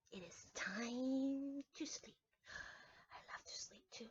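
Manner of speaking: soft sleepy whisper. Acted. Teacher telling children about sleep, in a whisper. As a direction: hush it like a bedtime secret.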